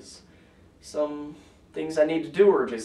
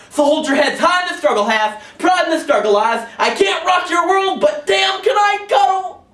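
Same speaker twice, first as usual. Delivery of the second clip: high, shouted spoken-word boast; slam rallying cry; 14 semitones higher, 10 dB louder than usual. Acted. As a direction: shout it proudly, rising to a peak.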